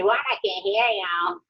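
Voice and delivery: slow, drawn-out mock complaint; imitating husband. Wife imitates her husband not hearing her, slow teasing complaint. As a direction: stretch it out, mimicking a husband. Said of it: "he'll say".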